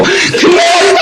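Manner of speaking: high, drawn-out celebratory shout; stretched-out, high-pitched victory cry; overjoyed cheer. Retold or imitated. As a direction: mimic a crowd cheering a win. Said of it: "좋고 막 춤추고".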